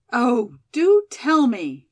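drawn-out, insistent entreaty; pleading. A narrator's reading. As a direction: beg to be told, stretching the words. Anxious Molly begs for news.

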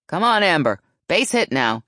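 loud rallying call to a batter; shouted encouragement. A narrator's reading. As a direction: shout it from third base. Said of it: "she shouted".

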